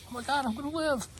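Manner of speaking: very high, emotion-choked exclamation; overwhelmed relief. Retold or imitated. Patient learning he will live, overwhelmed relief. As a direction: voice soaring with relief at being spared. Relives relief at the lab error.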